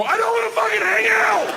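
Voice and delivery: high, loud furious yell through a door; angry shout. Retold or imitated. Old neighbor yelling at the knock, loud and high. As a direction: bellow it from behind the door. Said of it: "you hear the old man go".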